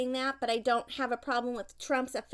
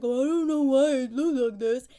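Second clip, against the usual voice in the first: mocking husband; 6 dB louder than usual. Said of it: "was like".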